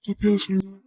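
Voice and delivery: loud, high pleading apology; anguished plea. Acted. Father begging his daughter to forgive him, anguished plea. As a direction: cry out the apology in despair.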